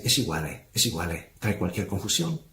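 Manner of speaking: low, quiet taunting voice of Satan; gloating devil murmuring low with an Italian lilt; mocking devil. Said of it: "Y el enemigo se ríe y dice".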